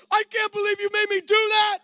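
high, loud furious shout; imitating an angry teen yelling, high and loud; angry daughter. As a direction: yell in outrage at dad. Angry daughter after show.

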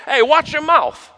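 high, slow mock parental warning; hammed-up New York parent telling off a kid; mock scolding parent. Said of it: "Did your parents ever tell you".

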